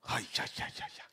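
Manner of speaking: breathless whispered aïe, aïe, fading away. Real, not acted. Preacher overcome, whispering his awe to the congregation. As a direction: let the aïe slip out as an awed whisper.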